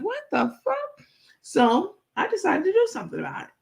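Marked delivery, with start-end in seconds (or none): 0.0-1.1 very high, slow squeal; shocked reaction. Retold or imitated. In her head hearing Take, high slow shocked squeal. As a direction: squeal it in disbelief like Grandma Holla. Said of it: "I was like".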